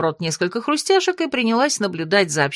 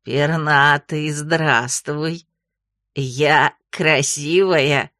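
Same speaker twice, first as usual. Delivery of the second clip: low, slow, coquettish croak; flirty frog voice; 5 semitones lower, much slower than usual. A narrator's reading. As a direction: flirt like a giant toad. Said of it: "кокетливо похлопала глазами".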